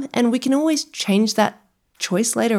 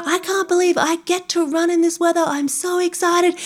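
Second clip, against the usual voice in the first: high, bubbly over-the-top excitement; gushing trainer; 10 semitones higher than usual. Retold or imitated. Imitating a TikTok trainer thrilled to run in freezing weather. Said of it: "she's like".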